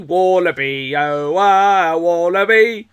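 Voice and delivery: loud chanted wallaby in a put-on Aussie accent; sing-song Australian novelty chant; mock Australian singsong. Two hosts clowning an Aussie jingle, mock Australian accent.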